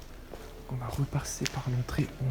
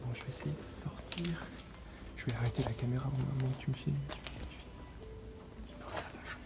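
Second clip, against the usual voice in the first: very soft nervous whisper; barely audible, afraid of noise; 9 dB quieter than usual. Real, not acted.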